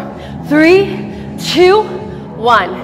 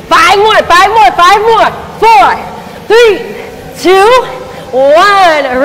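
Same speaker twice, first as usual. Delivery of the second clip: shouted countdown; 4 semitones higher, slower than usual. Real, not acted. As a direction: yell the last reps out. Final countdown pushing the class.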